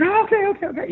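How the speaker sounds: very high, rattled agreeing; flustered wife. Retold or imitated. Wife agreeing to take an Uber, flustered. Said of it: "And she's like".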